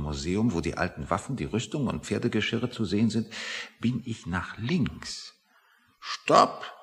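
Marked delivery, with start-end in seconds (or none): 6.0-6.7 sharp high shouted command; shouted stop. Retold or imitated. Soldier shouting at the trespasser to halt. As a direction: bark it out like a guard. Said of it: "rief ein Soldat".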